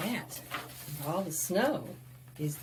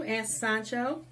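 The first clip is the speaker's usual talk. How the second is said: plain reading voice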